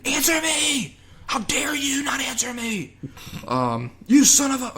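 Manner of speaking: very high, hammed-up outraged cry; mock screaming. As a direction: imitate an indignant yell, stretched out. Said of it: "Luigi screaming back".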